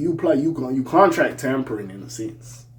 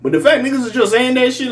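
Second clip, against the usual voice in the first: normal talking voice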